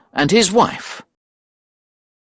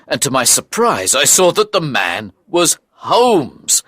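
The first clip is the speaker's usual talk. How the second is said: usual speaking voice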